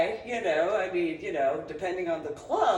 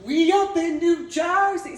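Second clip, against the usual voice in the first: louder, higher mocking brag; mock boast; 8 semitones higher, 9 dB louder than usual. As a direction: belt it out like a showing-off kid. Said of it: "I wasn't all".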